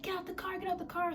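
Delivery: high, light mimicry of her demand; urgent repeated order, only lightly acted; mimicked demand. Other woman demanding at car.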